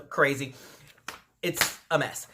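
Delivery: usual speaking voice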